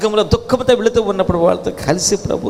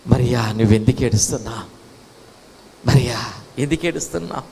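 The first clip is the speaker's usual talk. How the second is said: low, gentle question; tender consoling; 7 semitones lower than usual. Acted. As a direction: ask gently, comforting someone in tears. Jesus consoling weeping Mary.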